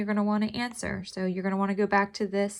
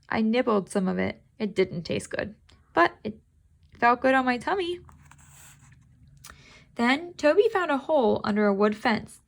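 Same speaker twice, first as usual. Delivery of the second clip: squeaky kitten meow, pitched way up; 3 semitones higher, 4 dB louder than usual. Acted. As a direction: cry it out like a little cat. Reads the story aloud.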